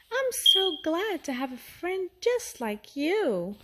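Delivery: put-on a small girl, glad and sweet; lightly voiced girl. A narrator's reading. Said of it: "said Judy".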